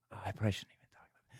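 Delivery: hushed, fast whisper. Real, not acted. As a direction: whisper it quickly to yourself. Unsure whether to tell the story.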